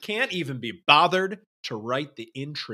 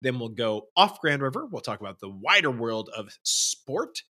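ordinary talk, nothing marked

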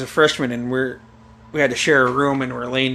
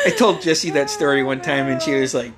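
ordinary talk, nothing marked

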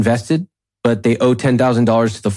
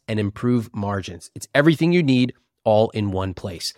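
upbeat sponsor read; 4 dB quieter than usual. Acted. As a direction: read it like a podcast ad.

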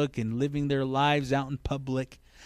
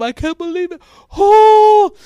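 high hammed-up wail, building louder; mock crying; 13 dB louder than usual. Preacher mimicking two minutes of vlog tears, mock crying. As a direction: blubber like an overdramatic vlogger. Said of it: "she's crying on camera".

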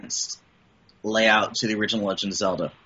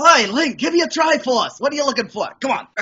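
high, loud, comically unthreatening villain; mock villain pitched way up; joking Ganon; 14 semitones higher, 3 dB louder than usual. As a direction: send up the big bad in a high-pitched voice. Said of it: "Yeah, I'm Ganon. Like".

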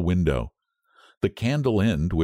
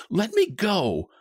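high, drawn-out demand to be released; struggling cry; 13 semitones higher, slower than usual. A narrator's reading. Wife struggling in her husband's grip, desperate cry. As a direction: cry it slow and high, fighting free. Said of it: "she cried, struggling".

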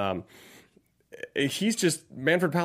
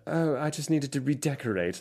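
imitating Jolie; 2 semitones higher, 4 dB quieter than usual. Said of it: "Angelina Jolie's delivery of that".

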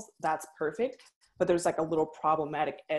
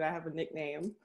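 normal talking voice

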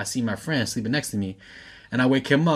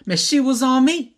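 put-on high, loud drunken protest; drunken imitation; 13 semitones higher, 6 dB louder than usual. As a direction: retell it as a drunk blurting out, pitched way up. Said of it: "Drunkenly, like".

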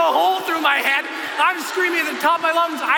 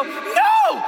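terrified no. Retold or imitated. Jumper refusing as instructor shoves him out, high panicked no. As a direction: protest in terror. Thrown out of the plane.

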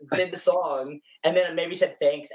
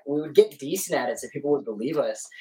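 ordinary talk

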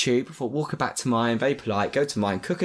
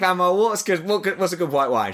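eager question; 5 semitones higher than usual. Retold or imitated. Phoning grandma for wine advice.

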